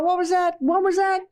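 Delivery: a playful startled organ crying out; lightly voiced organ caught off guard. Confused organs voiced in lesson.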